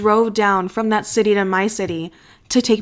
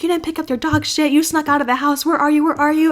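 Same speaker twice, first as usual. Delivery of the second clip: mock yelling; 6 semitones higher than usual. Friend calls pretending to scold her, imitated yelling. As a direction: imitate a frantic telling-off without real shouting. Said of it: "and she's yelling at me".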